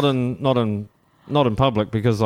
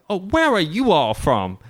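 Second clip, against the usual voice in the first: drawn-out, higher-pitched mock American question; retold American shopworker; 8 semitones higher, slower than usual. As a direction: imitate a curious American local, higher and slower.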